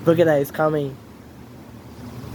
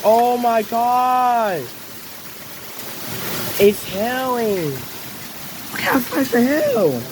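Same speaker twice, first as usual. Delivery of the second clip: in a hailstorm; 8 semitones higher, slower than usual. Real, not acted. Hail, slow drawn-out disbelief.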